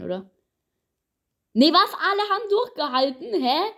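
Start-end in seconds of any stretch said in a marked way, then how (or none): 1.5-3.7 shocked outcry. Real, not acted. Shock everyone survived.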